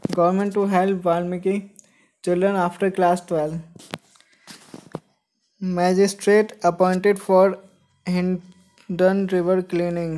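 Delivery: slow, deliberate newspaper headline reading. Acted. Host reads newspaper headlines to viewers, slow deliberate read-out. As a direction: read each headline out slowly, word by word.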